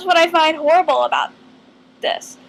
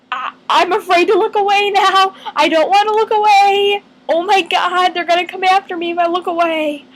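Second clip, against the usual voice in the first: higher-pitched, worried run of fearful thoughts; mock panic; 4 semitones higher than usual. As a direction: play up being scared to look away. Acting out fear of statues.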